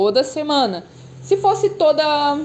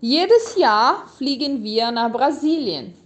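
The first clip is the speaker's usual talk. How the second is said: slow, clear, for repeating; measured teacher read-aloud; slow model reading. Shown as a demonstration. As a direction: read it slowly so learners can echo. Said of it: "Eu vou ler ele de novo pra você poder repetir".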